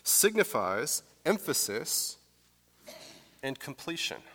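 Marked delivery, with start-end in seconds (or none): 0.0-2.2 "signifies emphasis" spelled out slowly for note-taking; teacher's slow dictation pace. Shown as a demonstration. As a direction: dictate it slowly so they can write. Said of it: "you might wanna write this down".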